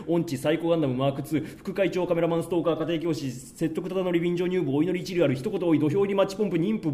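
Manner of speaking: long list recited in one breathless run; Jugemu-style rattling off. Real, not acted. Fan rattles off the character's endless alias to the hosts, Jugemu-style recital. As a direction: reel off the endless nickname, slowing on the last words. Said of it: "ジュゲム、ジュゲム、".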